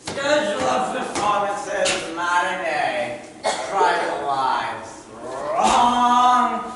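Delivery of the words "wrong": shouted. Acted. Shouts at a wrong schedule.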